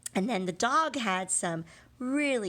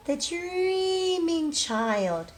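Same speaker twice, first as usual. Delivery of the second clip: very slow and higher, floating on dreaming; hushed storybook voice for kids; dreamy drawn-out phrase; 8 semitones higher, 6 dB louder, much slower than usual. Acted. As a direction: let dreaming drift out slowly. Teacher dramatizes sleepy story character.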